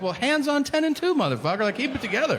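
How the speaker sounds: mock alarmed yell. Comic yelling at stoned driver.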